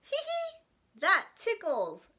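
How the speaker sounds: high giggle, then slow ticklish line; giggling. Acted. Larry giggling as his tummy is scratched.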